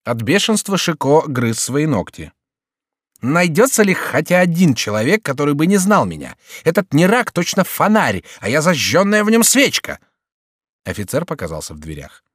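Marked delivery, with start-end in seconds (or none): 3.2-10.0 high, exasperated Chicot; worked-up, high-pitched complaint; fuming Chicot. Acted. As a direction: rant indignantly in a raised pitch.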